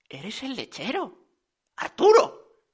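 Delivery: soft, then high-pitched recognition; hushed astonishment. A narrator's reading. Señora Smithy recognising the milkman, hushed astonishment. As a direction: start very quietly and lift on the name.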